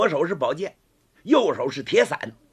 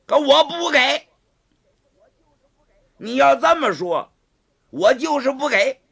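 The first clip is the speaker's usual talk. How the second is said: high, loud stubborn refusal; defiant refusal; 8 semitones higher, 6 dB louder than usual. Acted. Gao Feng flatly refusing to hand over the umbrella, defiant shout. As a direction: dig in and yell no.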